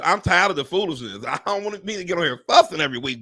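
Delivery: ordinary delivery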